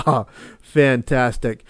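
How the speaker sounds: usual speaking voice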